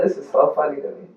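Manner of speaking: quick, low aside to oneself; inner thought. Retold or imitated. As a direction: say it softly and fast, as if thinking. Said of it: "in my mind".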